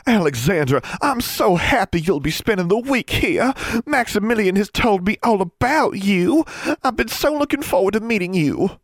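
put-on very high, bubbly girlish voice; thrilled, high-pitched welcome; gushing older sister. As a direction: gush with delight at meeting her. Enthusiastic older sister greets her.

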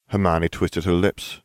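plain reading voice

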